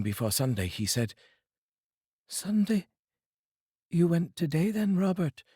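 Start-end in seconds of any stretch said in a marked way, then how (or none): 2.3-5.4 higher wife's voice, gently asking; concerned wife. Acted. Wife asks Bob.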